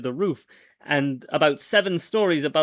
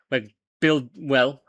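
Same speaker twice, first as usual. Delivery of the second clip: ordinary delivery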